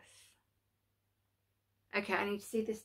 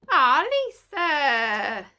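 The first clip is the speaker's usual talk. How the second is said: touched; 3 semitones higher, 9 dB louder, much slower than usual. Real, not acted. Touched by a friend's gift.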